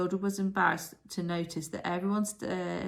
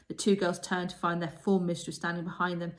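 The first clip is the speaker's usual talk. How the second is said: ordinary delivery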